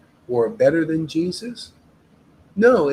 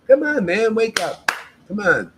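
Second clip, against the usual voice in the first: urgent call; 5 semitones higher, 4 dB louder than usual. Real, not acted. Urging listeners to wake up.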